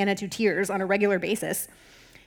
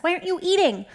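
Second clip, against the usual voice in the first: lightly voiced husband, pitched up; a higher-pitched read of his question; Elkanah's question; 7 semitones higher than usual. A narrator's reading. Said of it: "Elkanah would ask".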